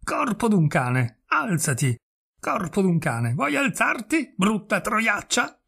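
slow, menacing bark, pitched well up at the end; a parrot mimicking a dead husband's curses; hammed-up furious yelling; furious thundering voice. A narrator's reading. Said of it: "la voce furibonda, la voce tonante che vociferava".

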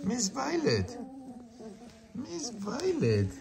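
high soft call to the puppy; high soft pet name; sweet calling. Real, not acted.

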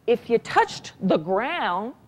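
ordinary talk, nothing marked

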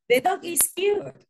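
high, bright gushing praise; excited exclamation. Shown as a demonstration. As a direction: sound thrilled about the dog. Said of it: "they show strong feelings".

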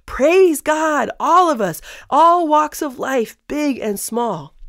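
high-pitched rallying call, easing down at the end; rally cry. Acted. Voice from heaven summoning all to praise, rally cry. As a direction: call everyone to join in, slow and emphatic. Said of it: "he's giving this rally cry".

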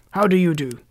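lightly husky, emphatic how-do-you-do; Scarecrow. A narrator's reading. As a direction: greet heartily with a touch of huskiness. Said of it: "in a rather husky voice".

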